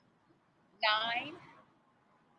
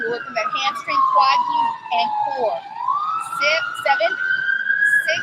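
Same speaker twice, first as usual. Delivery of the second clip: high, loud hype calls; loud coach shout; 8 dB louder than usual. Real, not acted. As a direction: pump the class up, loud and high. Coach pumping up class mid-count.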